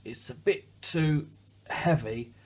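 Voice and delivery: heaving under a weight; straining grunt. A narrator's reading. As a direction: strain as if lifting something heavy. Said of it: "grunted Douglas".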